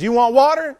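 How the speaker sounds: high, loud mock-furious retort; angry Moses. Moses snapping at the thirsty people, high and loud. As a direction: snap it at the people like a fed-up leader. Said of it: "Moses was royally mad at them".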